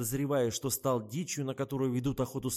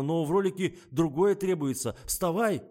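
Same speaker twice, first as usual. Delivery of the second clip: high, raised-voice order; loud urging; 6 semitones higher, 7 dB louder than usual. A narrator's reading. Kirsavin orders the fallen actress up, loudly. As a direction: call her up off the couch.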